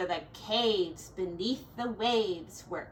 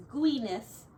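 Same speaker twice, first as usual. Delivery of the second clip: ordinary delivery, nothing marked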